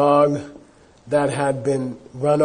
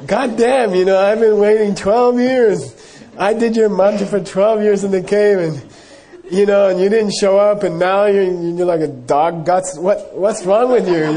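raised, louder voice, scolding in frustration; retold angry outburst; 5 semitones higher, 5 dB louder than usual. Hermit scolding the long-awaited teacher, angry raised voice. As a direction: vent years of waiting at him. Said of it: "he gets angry".

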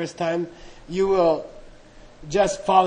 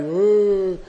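spooky ooh. Retold or imitated. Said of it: "It's like Halloween".

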